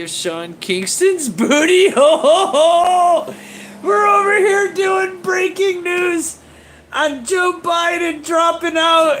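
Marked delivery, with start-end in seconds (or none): 1.3-3.3 booty blurted high into a loud ho ho ho; a loud, high, cackling ho ho ho; loud laughing. Real, not acted. 3.8-9.2 high, loud incredulous shout; shouting high over the breaking news; excited shout. Real, not acted. Laughing shout at the absurdity.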